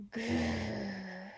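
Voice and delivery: low rumbling growl. A narrator's reading. Teacher growls at the class, low rumbling growl. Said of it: "growled Mister Wright".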